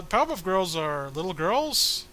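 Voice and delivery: normal talking voice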